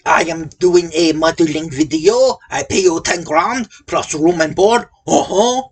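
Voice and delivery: thick French accent, mock; hammed-up French accent. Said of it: "my French accent".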